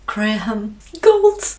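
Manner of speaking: ordinary talk, nothing marked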